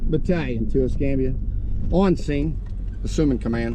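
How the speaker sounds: over a fire radio; radio call. Real, not acted. Chief radioing in on scene.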